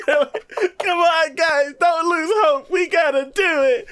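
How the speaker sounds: high, slow, wailing come on guys; mock crying pep talk. Imitating the anime captain begging the team, mock tearful. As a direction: overact a tearful rally. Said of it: "And I'm like".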